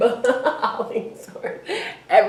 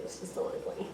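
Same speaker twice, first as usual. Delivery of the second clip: whisper; 22 dB quieter, much faster than usual. Real, not acted. Whispered complaint.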